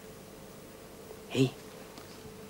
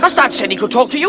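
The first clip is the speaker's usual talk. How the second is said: higher, loud scolding; angry accusation; 9 semitones higher, 4 dB louder than usual. Acted. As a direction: lash out at the sister. Brother scolds sister.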